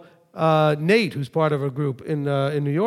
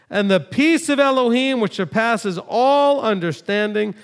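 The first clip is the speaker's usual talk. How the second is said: raised, ringing scripture read, higher and louder; preacher reading scripture to the congregation; 4 semitones higher, 3 dB louder than usual. Acted. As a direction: proclaim the verses from the pulpit. Reads Philippians aloud with force.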